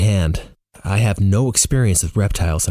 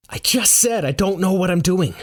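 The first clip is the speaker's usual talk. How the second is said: high, exasperated helplessness; panicked protest; 10 semitones higher than usual. Acted. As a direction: insist you are out of your depth.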